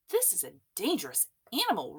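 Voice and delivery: fast, lightly roared warning; a mild hint of a roar, hurried. A narrator's reading. Police chief warning the crowd, mild roar. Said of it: "roared the chief".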